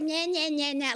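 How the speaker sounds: high, nagging imitation of his talk; childish mocking. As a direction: mimic him like a bratty kid. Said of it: "Like you're a freaking kid".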